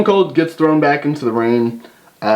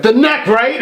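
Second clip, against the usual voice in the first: higher, lightly voiced Bret; mock Bret; 10 semitones higher than usual. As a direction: ham up a clueless question. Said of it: "he's like".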